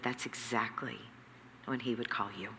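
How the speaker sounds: hushed, soft voice of a wise mentor; quiet quote. Retold or imitated. Man of God quietly answering her rant, hushed and calm. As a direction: say it gently and low, like a quiet revelation.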